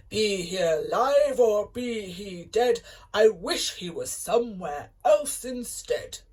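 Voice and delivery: loud booming giant roar. A narrator's reading. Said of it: "roared the giant".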